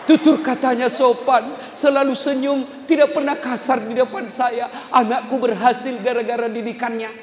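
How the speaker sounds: high, hammed-up lament with laughter; mock grieving husband. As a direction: overdo the widower's sorrow. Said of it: "lalu suamimu menangis". (suami meratapi istri, ratapan dibuat-buat)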